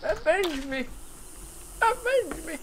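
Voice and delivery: rising into a tiny high falsetto, fading; mock dying plea. Fallen player begging teammate for revenge, mock dying squeak.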